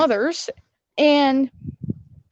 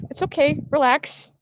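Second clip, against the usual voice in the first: usual speaking voice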